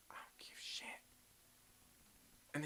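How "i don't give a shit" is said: fast, softer whisper; whispered dismissal. Pretend. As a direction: hush it out like a brush-off. Telling a bro off, whispered.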